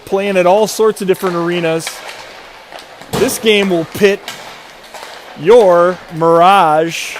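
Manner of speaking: slow, drawn-out game introduction; arena announcer. Acted. Broadcaster introducing the hockey matchup to viewers, slow announcer delivery. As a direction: stretch the names out like a rink announcer.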